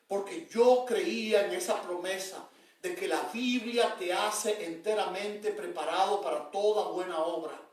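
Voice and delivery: usual speaking voice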